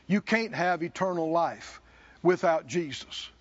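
ordinary talk, nothing marked

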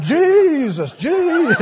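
high, drawn-out mock cry; comic crying out. Seeker shaking his head as told, comic crying out. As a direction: imitate a seeker wailing the name.

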